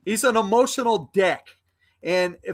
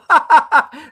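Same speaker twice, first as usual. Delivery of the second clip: loud scornful burst of laughter; mocking laugh; 6 semitones higher, 10 dB louder than usual. Real, not acted. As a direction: laugh hard in his face. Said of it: "I'm laughing so hard at you".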